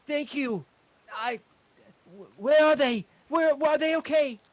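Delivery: high, rising to loud urgency; frantic halfling. Acted. Halfling asking his healer about family, frantic. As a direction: stammer out worried questions.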